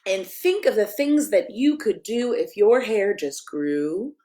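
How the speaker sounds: lively picture-book read-aloud, sing-song rhymes; reading to children. Acted. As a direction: read it like a bedtime story for kids.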